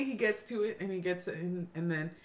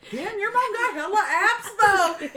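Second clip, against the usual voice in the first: high, loud exclamation through laughter; hammed-up impressed outburst; amused amazement; 11 semitones higher, 8 dB louder than usual. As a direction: blurt it out, cracking up. Retold remark while laughing.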